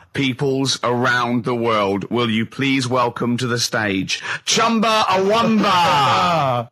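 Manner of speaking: slow, grand stage announcement; mock MC intro; slow announcer. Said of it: "Ladies and gentlemen".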